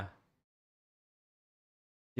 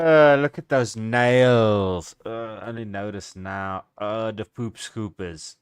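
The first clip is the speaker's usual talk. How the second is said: real disgust; 7 dB louder than usual. Real, not acted. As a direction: recoil out loud from something gross. Commentator reacts to nails with disgust.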